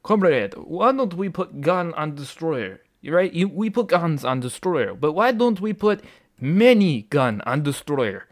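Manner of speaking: hammed-up fake Russian accent; mock Russian engineer. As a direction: play a comic Russian engineer with a thick accent. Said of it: "possibly drunk Russian ship engin".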